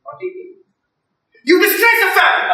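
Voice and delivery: high, shrill granny outrage; angry grandma. Retold or imitated. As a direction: scold like a furious old grandmother. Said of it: "And she's like".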